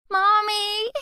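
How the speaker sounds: put-on high little girl yelling mommy; a frightened toddler shouting; yelling child. A narrator's reading. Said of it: "she yells".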